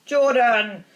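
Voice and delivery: loud, drawn-out scolding call; sharp reprimand. A narrator's reading. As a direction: cut in with a loud telling-off. Professor reprimands the commentator.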